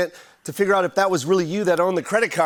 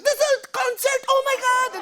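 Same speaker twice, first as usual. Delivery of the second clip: very high, horrified mother shriek; mock-shocked mom discovering it's a concert; hammed-up high-pitched mother's outcry; imitated mom. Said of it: "she was like".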